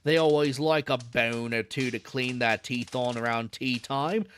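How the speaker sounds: put-on old London zookeeper in a Cockney accent. Zookeeper chatting to a visitor, Cockney accent. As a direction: drop the aitches, Cockney keeper at ease. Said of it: "This guy is such an accent".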